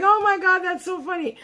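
high-pitched, gushing "oh my God" reenactment; amused reaction. Retold or imitated. Retelling her laugh at opening the card, mock delighted outburst. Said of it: "laughs when I opened it. I was like".

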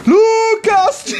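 high, loud excited shriek; shrieking in excitement. Real, not acted. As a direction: scream his name, thrilled. Friend wipes out sledding.

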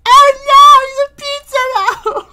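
very high, crying cartoon lady; panicking Screen Lady. Screen Lady panics about making pizza, high crying wail.

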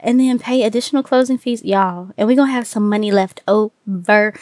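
normal talking voice

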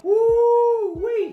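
high, loud drawn-out whoop; nervous whoop. Real, not acted. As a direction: let out a long high holler. Hair dye starts, nervous whoop.

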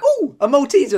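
high, loud delighted nurse; eager nurse voice. Retold or imitated. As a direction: sound thrilled by the offer. New nurse eager for sweets.

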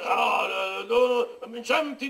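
ordinary talk, nothing marked

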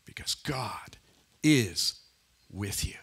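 low and very soft, word by word; slow down and drop nearly to a whisper; hushed reassurance. Retold or imitated. Preacher repeating the prophet's promise to believers, hushed and deliberate.

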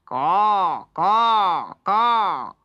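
loud harsh cawing; loud crow calls. Acted. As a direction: caw like a crow. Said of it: "all he could do was".

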